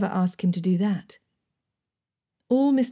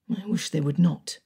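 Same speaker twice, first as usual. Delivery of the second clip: grumbling; 3 semitones lower than usual. A narrator's reading. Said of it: "Mister Darcy grumbled".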